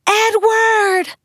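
high-pitched, drawn-out desperate cry; desperate scream. A narrator's reading. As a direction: scream the name out across a crowd. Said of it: "I screamed".

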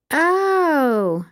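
bright high cheerful "oh". A narrator's reading. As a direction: sound cheerful, hiding mild disappointment. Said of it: "Jess said cheerfully".

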